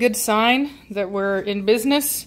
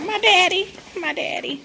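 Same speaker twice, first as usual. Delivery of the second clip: voicing the excited dog; 9 semitones higher than usual. Owner voicing her dog again, high then trailing off. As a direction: coo it as the thrilled pup. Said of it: "She's like".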